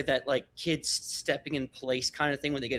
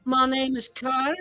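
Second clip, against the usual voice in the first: put-on much higher, eager little-kid voice, puffed up; a tiny self-appointed leader announcing himself; proud orc kid leader; 11 semitones higher, 4 dB louder than usual. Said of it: "he's like, he's like".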